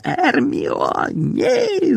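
muffled shout leaping high; strained, stifled protest; gagged cry. A narrator's reading. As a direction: cry out through a gag. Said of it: "закричав Рон з кляпом у роті".

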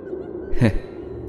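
a low, breathy cackle from a ghost; eerie ghost cackle. Acted. Ghost mocking the old beggar with an eerie cackle. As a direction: let out a hollow, eerie laugh. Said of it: "cất tiếng nói âm u".

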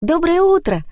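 high-pitched sing-out good morning; chirpy morning hello; cheery greeting. A narrator's reading. Cheerful friend enters the room.

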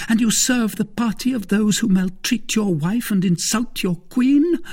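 high voice climbing to the end; indignant cry. A narrator's reading. Wife defending herself and her queen, indignant. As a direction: accuse him, mounting in outrage. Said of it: "cried she".

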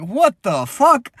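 high, slow what the fuck; stunned disbelief. Retold or imitated. Reacting to her pregnancy photo, slow high disbelief. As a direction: drag it out in disbelief. Shocked by the baby post.

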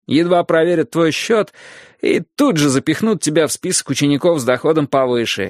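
usual speaking voice

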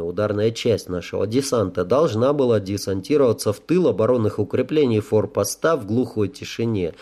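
plain reading voice

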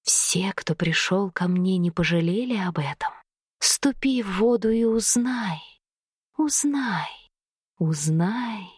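low, hushed hissing whisper; snake woman voice. A narrator's reading. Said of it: "похожим на змеиное шипение".

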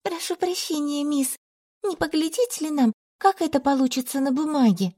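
naive. A narrator's reading. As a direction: ask with innocent simplicity. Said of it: "простодушно сказала".

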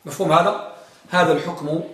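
ordinary talk

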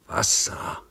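voiceless, faint groan of a parched man; whispered moan from thirst; groaned plea. Acted. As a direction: barely breathe the word out. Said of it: "stöhnte Iwan".

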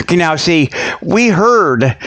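normal talking voice

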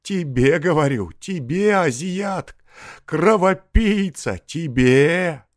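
high, loud accusing cries; enraged peasant's shrieked abuse; furious name-calling. A narrator's reading. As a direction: fling insults in a desperate rage. Peasant insults the forester.

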